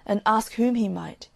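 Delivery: ordinary delivery, nothing marked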